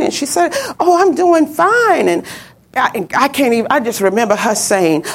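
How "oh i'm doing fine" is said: imitating a happy girl, higher and drawn out; joyous. Girl answers mother on the phone, joyous. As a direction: play her cheerful and slow. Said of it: "she was just joyous, happy".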